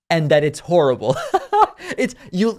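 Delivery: a disbelieving laugh breaking through. Real, not acted. Host laughing at Trump's remark about unknown languages, incredulous laugh. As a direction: laugh at how absurd the quote is.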